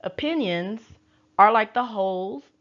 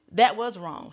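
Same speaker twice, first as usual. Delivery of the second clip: ordinary talk, nothing marked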